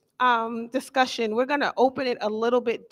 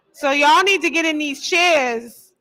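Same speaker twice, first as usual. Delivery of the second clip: mom voice; 5 dB louder than usual. Real, not acted. Host uses her mom voice to get the crowd seated. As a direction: lay down the law like a mom. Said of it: "I done brought the mom voice out".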